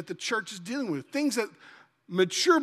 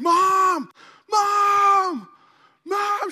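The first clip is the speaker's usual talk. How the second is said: put-on high, loud child's call for mom; retold scream for mom; 11 semitones higher, 7 dB louder, much slower than usual. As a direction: yell for mom from a dark bedroom. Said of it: "I'd be screaming".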